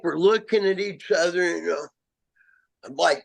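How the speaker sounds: normal talking voice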